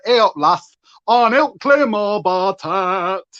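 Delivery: sung slowly in a broad Yorkshire accent. Shown as a demonstration. Vlogger showing the song as it would sound in a Yorkshire accent. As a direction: sing it Yorkshire style, hey up lass. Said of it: "why is that never sung in a Yorkshire accent".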